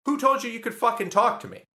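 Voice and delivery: high-pitched indignant comeback, retold; hammed-up aggressive challenge to a stranger; aggressive retort. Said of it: "that was really aggressive".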